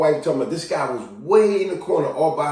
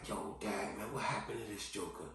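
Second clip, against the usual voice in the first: very soft, low remark; hushed, imitated onlooker's aside; muttered aside; 6 semitones lower, 21 dB quieter than usual. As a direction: mutter it privately about a passerby. Muttered reaction to seeing someone.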